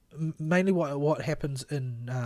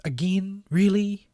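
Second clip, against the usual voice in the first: higher and louder, disbelieving; incredulous; 8 semitones higher, 8 dB louder, slower than usual. Real, not acted. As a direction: react in real disbelief. Incredulous reaction to hiccups.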